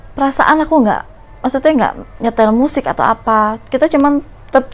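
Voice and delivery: ordinary delivery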